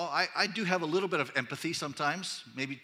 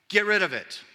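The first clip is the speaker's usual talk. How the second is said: louder blunt order; Paul's curt instruction, delivered louder; blunt command; 4 semitones higher, 7 dB louder than usual. Retold or imitated. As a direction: bark it out flatly.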